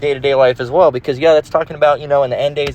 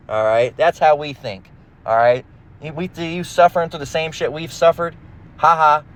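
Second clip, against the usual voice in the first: usual speaking voice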